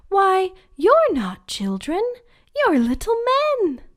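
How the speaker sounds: put-on high, loud startled girl. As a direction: blurt it out in surprise.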